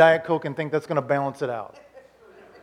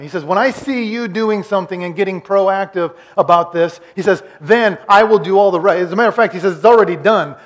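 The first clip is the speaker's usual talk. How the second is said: raised, insistent preacher's voice; fired-up preaching; 2 semitones higher, 7 dB louder than usual. Acted. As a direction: urge the congregation on at full force. Preaches at full force.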